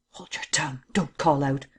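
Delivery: hushed, whispered threat; menacing whisper, kept under the breath; low voice. A narrator's reading. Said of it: "he said in a low voice".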